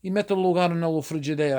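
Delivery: Italian-American dialect; Italian-accented "frigidaire" in a dialect phrase; run-together immigrant Italian; Italglish. Shown as a demonstration. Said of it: "So they say".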